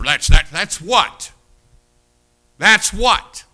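loud, slow stunned "what"; mock astonishment. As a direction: play the shocked churchgoer. Astonished listener quoted.

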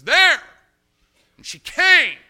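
shouted high and loud; preaching shout. Real, not acted. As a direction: cry it out to the congregation. Preacher building to climax.

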